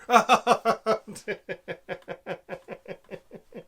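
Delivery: a big burst of real laughter; loud laugh. Real, not acted. Laughs at Luke's line.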